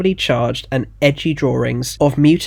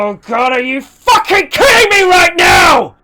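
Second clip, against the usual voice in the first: drawn-out groan building into a furious shout; yelled; 11 dB louder than usual. Acted. Gallery owner learning who the client is, furious yell. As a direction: climb from exasperation to a screaming yell. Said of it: "yelled the owner".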